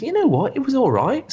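high, loud mocking imitation. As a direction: mimic him in a high, silly voice. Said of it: "and Luke says".

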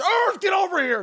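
very high-pitched shouted command; calling the dog. Acted. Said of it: "to my dog".

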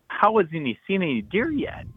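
retold bafflement, voice swooping up; baffled disbelief. Hunter wondering about his friend's empty stand, incredulous.

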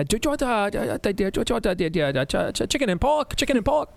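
high put-on Chinese restaurant accent, stammering; mock Chinese restaurant. Prank calling a teacher, in a put-on Chinese restaurant accent. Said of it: "do the Chinese restaurant".

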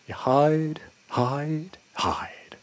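lyric fading away into a whisper; fading echo. Retold or imitated. Imitates the echoing album vocal.

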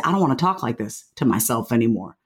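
tender vow to yourself; gentle self-talk. Shown as a demonstration.

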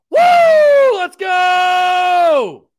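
loud whooping hype cheer; excited shout. Real, not acted. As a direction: yell it out, pumped for kickoff. Hype for kickoff on the show.

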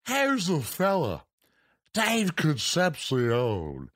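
slow, drawn-out sportscaster impression; Harry Carey. Introducing a player from Havana, slow drawling announcer impression. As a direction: stretch the names way out like an announcer. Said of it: "everybody does a Harry Carey".

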